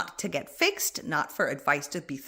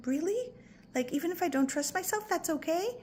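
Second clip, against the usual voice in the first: higher, lightly voiced hopeful little girl; inner child; 9 semitones higher, 4 dB quieter than usual. Scared inner child asking if it's allowed, lightly high. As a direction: ask like a surprised small child. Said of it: "She's gonna be like".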